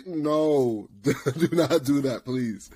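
ordinary talk, nothing marked

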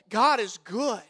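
usual speaking voice

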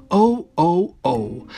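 usual speaking voice

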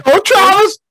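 high, mock dramatic call; meme quote. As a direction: sing out the name like the clip. Quoting video voice.

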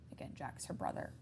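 usual speaking voice

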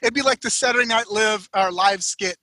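ordinary talk, nothing marked

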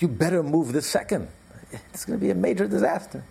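high, urgent order; acted urgent authority; alarmed top official. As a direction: raise the pitch and warn of catastrophe. Secretary of Navy warns ship.

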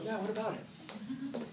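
slow, high, unsure agreement; giving in, pondering. Acted. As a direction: take the accusation to heart, drawn out. Giving in to the accusation.

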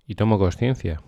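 ordinary delivery

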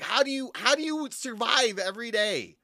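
high-pitched incredulous wonder; mock disbelief. Worker reacting to a clueless customer, exaggerated disbelief. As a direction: marvel at it in a high, stretched voice.

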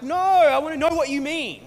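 high, loud exasperated protest; acted frustration. Reader protesting Paul's vague riddles, frustrated. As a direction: push back hard, demanding a straight answer. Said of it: "This is frustrating".

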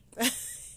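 a breathy, choked laugh through held-back tears; tearful laugh. Real, not acted. Podcaster laughing while trying not to cry. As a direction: laugh while fighting off crying. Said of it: "even while laughing".

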